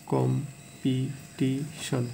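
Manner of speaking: Competition very slowly, clear gaps between কম, পি, টি, শন; syllable by syllable. Shown as a demonstration. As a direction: break competition into four separate pieces. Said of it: "চারবারে আমরা ভাঙাইতেছি". (Competition থেমে থেমে চার ভাগে ভেঙে বলা)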